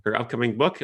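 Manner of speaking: normal talking voice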